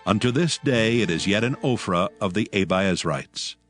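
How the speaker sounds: ordinary talk, nothing marked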